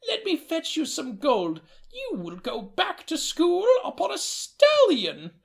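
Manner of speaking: high quavering grandmother voice; a doting old nana greeting her boy; delighted old woman greeting her grandson, high-pitched. Acted. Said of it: "Emma Joe retrieved the funds".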